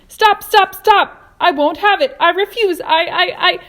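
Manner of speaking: very loud, high panicked shouting. A narrator's reading. Earthworm shrieks in panic at the plan, very loud and high. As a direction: shriek your refusal.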